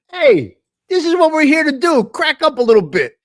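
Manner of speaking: bubbling over with glee at the joke; excited, laughing. Real, not acted. Still laughing over prank.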